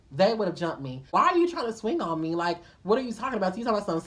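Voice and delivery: ordinary talk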